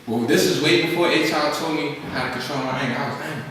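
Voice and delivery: ordinary talk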